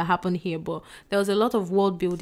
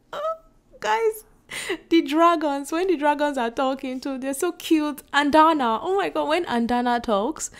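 high, loud fangirl gushing about the dragons; excited gushing; 7 semitones higher, 3 dB louder than usual. Real, not acted. Gushing to viewers about cute talking dragons, excited. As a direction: squeal over how cute they are. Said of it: "I know that I'm talking like this".

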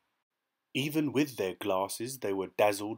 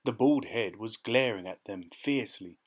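ordinary delivery

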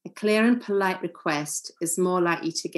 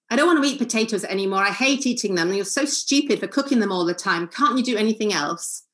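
fast, louder demo of a grumpy complaint; 3 semitones higher, 4 dB louder, faster than usual. Shown as a demonstration. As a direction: rattle off the complaint, irritated and louder. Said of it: "a grumpy complaint".

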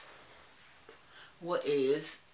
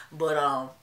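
ordinary talk

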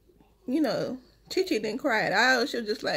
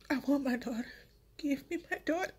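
softer, pleading, close to tears; tearful plea; 2 semitones higher, 4 dB quieter, faster than usual. Retold or imitated. Mother retelling begging staff for her newborn, tearful and soft. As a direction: beg for the baby back through tears. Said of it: "I'm sorry I be crying".

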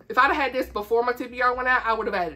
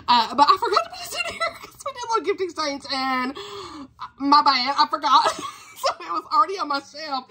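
laughing; 5 semitones higher than usual. Real, not acted. Laughing at forgetting the gift.

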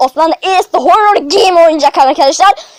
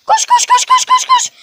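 very high, frantic run call; frantic high panic. Real, not acted. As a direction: squeal in terror to run. Granny closing in.

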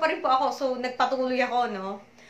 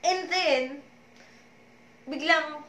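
usual speaking voice

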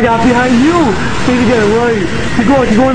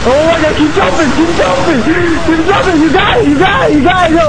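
high, rapid-fire egging on; excited urging; 5 semitones higher than usual. Real, not acted. Gamer urging teammate to keep jumping, excited. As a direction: cheer them on urgently, pitched high.

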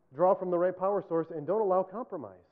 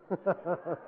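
real laugh at own joke; amused laughter; chuckle; 4 dB quieter than usual. Real, not acted. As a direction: chuckle at your own corny punchline.

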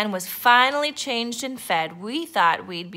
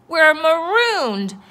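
high, loud, drawn-out pirate despair; despairing cry; 9 semitones higher, 9 dB louder, slower than usual. A narrator's reading. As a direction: cry out as if all is lost. Pirate captain despairs aloud.

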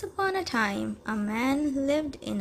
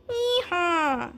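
high hee-haw; donkey bray; 7 semitones higher, 4 dB louder than usual. Acted. Narrator voicing the donkey, braying. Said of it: "The donkey said".

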